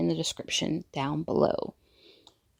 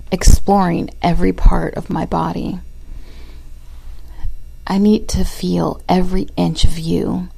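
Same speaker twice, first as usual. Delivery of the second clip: loud, intimate spoken-word reading; poem recital; 10 dB louder than usual. Acted.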